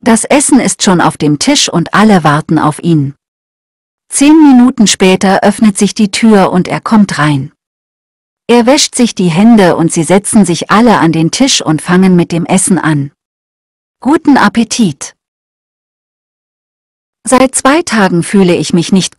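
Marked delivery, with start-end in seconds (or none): none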